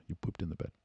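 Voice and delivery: soft, kind confirmation; gentle boyfriend. Acted. Boyfriend answers sobered-up girlfriend, gently hushed. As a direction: confirm it very quietly. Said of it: "You go".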